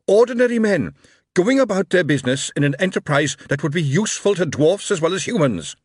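loud, high outraged shout; angry shouting. A narrator's reading. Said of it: "he shouted".

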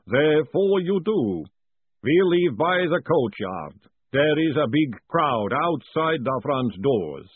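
slow, steady golem; measured, unhurried and dutiful. A narrator's reading. Golem wakes Moist, slow and even.

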